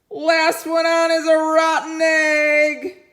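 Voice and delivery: high, loud, teasing call; taunting chicken. Acted. Chicken daring the others to climb on. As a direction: crow it like a playground dare.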